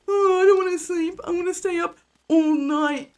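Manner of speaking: put-on high, whining little kid voice; whiny child. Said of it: "being like".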